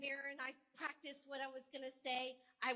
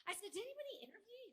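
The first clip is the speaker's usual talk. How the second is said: much higher, rushing, incredulous; indignant excitement; 9 semitones higher, faster than usual. Retold or imitated. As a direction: burst out in disbelief. Asking colleague, indignant and excited.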